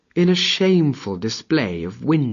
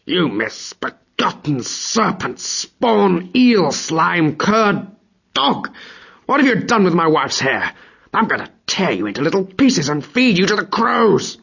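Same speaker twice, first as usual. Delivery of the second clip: roaring Thor; 6 semitones higher than usual. Acted. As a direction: spit each insult like a raging god.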